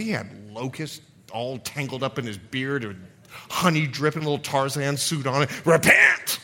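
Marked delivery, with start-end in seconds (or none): 5.7-6.4 wild prophet's high, loud shout; John the Baptist. Acted. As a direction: bellow it like a desert preacher. Impersonates John the Baptist preaching.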